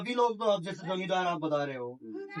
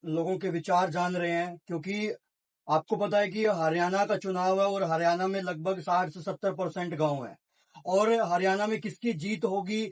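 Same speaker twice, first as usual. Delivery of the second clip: measured field-report delivery, a little higher; reporter to camera; 3 semitones higher than usual. Acted. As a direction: sum up the story like a TV reporter. Reporter addresses camera, explains election.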